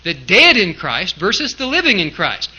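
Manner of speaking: "dead" punched loud, set against "living"; contrastive stress. Shown as a demonstration. Preacher shows the emphasis we ought to have, stressing "dead" against "living". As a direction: hit "dead" and "living", keep both "in Christ" light. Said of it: "that's the emphasis that we ought to have".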